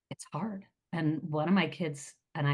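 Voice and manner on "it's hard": whispered sigh. Real, not acted. Sighing admission of hard times.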